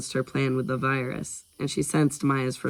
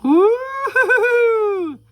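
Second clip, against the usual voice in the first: very high, long, loud "hoo-hoo"; drawn-out falsetto creepy laugh; creepy hooting giggle; 8 dB louder, much slower than usual. Pretend. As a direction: hoot eerily like a creep. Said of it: "That's creepy".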